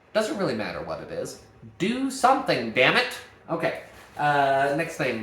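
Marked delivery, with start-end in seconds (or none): 1.8-3.2 high, loud mock exasperation; exasperated urging. Real, not acted. Teacher playfully scolds students to fill pauses, loud. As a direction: push them to just do something.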